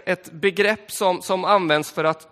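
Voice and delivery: ordinary talk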